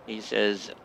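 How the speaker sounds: ordinary talk, nothing marked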